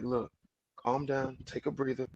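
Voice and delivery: soft, low, coaxing; soothing calm-down. Retold or imitated. Man calming his upset woman, soft and coaxing. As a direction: talk her down tenderly. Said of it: "he can bring her down and be like".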